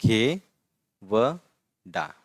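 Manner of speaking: घे व डा, slow and broken into syllables. Shown as a demonstration. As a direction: sound out each syllable slowly. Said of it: "फिर ये है".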